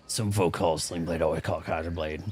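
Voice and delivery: a lightly done Billy Bob impression; Billy Bob Sling Blade voice. Retelling the movie line to friends, in a low Sling Blade voice. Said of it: "his Sling Blade voice".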